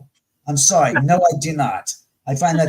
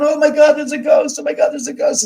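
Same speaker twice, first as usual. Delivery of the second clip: much higher, louder hammed-up fright; mock panic; 12 semitones higher, 4 dB louder, faster than usual. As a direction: parody someone freaking out over a ghost. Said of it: "you don't see me here crying, going".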